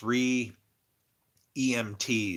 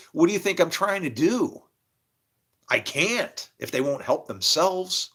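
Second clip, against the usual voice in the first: higher, louder incredulous reply; exasperated retort; 7 semitones higher, 4 dB louder than usual. Retold or imitated. Man answering the medium in exasperation. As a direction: throw it back at her, fed up. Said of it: "And I'm like".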